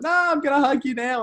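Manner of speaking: high and loud, gleefully insisting; spring it on him with a squeal; excited hug threat. Retold or imitated. Fan telling Hindmarsh he's getting a hug, high excited. Telling player he'll get hugged.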